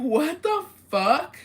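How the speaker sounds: high, loud, drawn-out disbelief; retold shock. Reacting to the duende story, loud acted shock. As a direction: stretch it out loud and high, incredulous.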